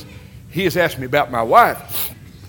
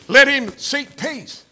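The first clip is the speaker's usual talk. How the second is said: each word drawn out slowly, higher; slow scripture; 7 semitones higher, much slower than usual. Acted. Preacher reading First Peter to the church, slow and weighty. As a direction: lean on every word of the verse.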